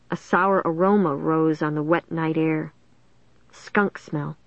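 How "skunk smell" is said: ordinary delivery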